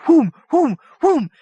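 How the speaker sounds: loud rising whoosh noises; whooshing sound effect. A narrator's reading. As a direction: swoop the sound up high and loud. Cheeseburgers multiplying in vision.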